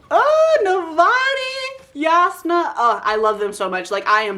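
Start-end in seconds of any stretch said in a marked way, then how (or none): 0.1-2.7 gushing squeal. Real, not acted. Gushing over favourite characters.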